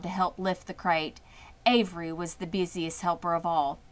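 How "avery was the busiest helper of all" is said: ordinary delivery, nothing marked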